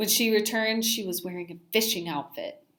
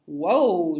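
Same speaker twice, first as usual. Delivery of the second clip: startled; 8 dB louder than usual. A narrator's reading. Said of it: "she cried".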